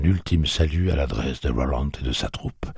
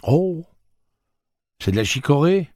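high, louder surprised question; 13 semitones higher, 6 dB louder than usual. A narrator's reading. Susannah tastes the coffee, high and surprised. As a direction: taste it and be caught off guard. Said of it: "parut surprise".

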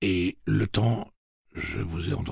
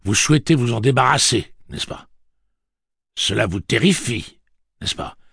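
loud and higher, rising pressure; thundering; 6 semitones higher, 7 dB louder than usual. A narrator's reading. Roland pressing Callahan about the object, thundering. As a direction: hammer the question home. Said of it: "tonna Roland".

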